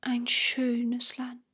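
soft, slow murmur to oneself; dreamy murmur. A narrator's reading. Bengele half captivated by the land, slow soft murmur. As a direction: murmur it slowly, half won over. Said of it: "murmelte Bengele".

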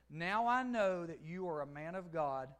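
full-force pulpit reading; fervent preaching. Acted. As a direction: preach it out big and slow.

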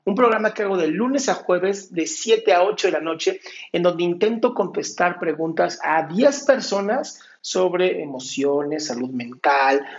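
loud, projected presenter read; programme intro. Real, not acted. Recorded intro to the show.

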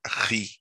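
"ri" sounded alone as a separate syllable; teacher isolating the syllable "ri"; isolated syllable. Shown as a demonstration. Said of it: "A segunda sílaba". (sílaba "ri" dita isolada)

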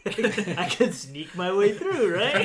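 chuckling, rising high on right; laughing. Retold or imitated. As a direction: laugh while plotting, voice jumping up. Scheming plan, laughing.

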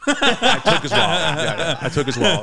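loud laughing joke; giggling confession. Real, not acted. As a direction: laugh out the punchline.

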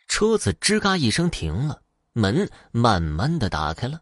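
ordinary delivery, nothing marked

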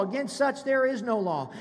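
ordinary talk, nothing marked

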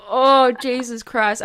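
slow embarrassed exclamation; mock despair. Real, not acted. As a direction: drag it out in embarrassment. Embarrassed at own crying.

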